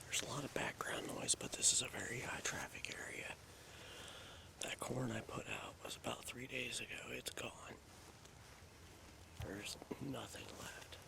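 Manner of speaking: hushed whisper so game won't hear; breathy hunter's whisper, barely audible. Real, not acted. Whispering in hunting area.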